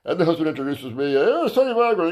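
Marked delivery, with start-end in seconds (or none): 1.1-2.1 pitched way up, lightly announced; high mock announcer. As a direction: mimic an excited host introduction. Husband announcing him.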